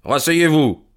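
firm, curt order; stern detective putting him in his place. A narrator's reading. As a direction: command it with cool severity. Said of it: "dit Holmes sévèrement".

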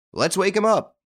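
high, lively suggestion; eager call. A narrator's reading. Card player urges the others to wake him, high eager call. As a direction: pitch it up, eager. Players rousing sleeping man.

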